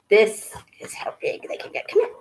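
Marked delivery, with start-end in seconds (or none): none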